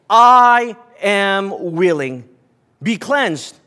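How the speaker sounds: very slow, weighty and sure; solemn, slow assurance. A narrator's reading. Jesus answering the leper, slow solemn assurance. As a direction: grant it gently but with authority.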